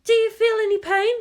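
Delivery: a caring nurse checks on her patient; gentle nurse. Acted. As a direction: nurse asking an injured girl about pain. Nurse asks the injured girl.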